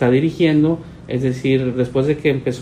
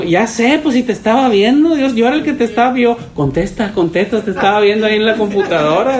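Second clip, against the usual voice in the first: mock excited, high and louder; excited caller; 6 semitones higher, 4 dB louder than usual. Pastor imitating the eager caller who watched online, high and excited. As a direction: replay the caller's eager rush, pitched up.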